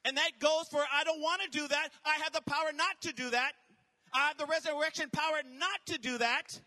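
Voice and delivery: rising, loud sermon build; building from firm to shouting; fiery preaching. Acted. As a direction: preach it to the crowd with fire. Preaching continues.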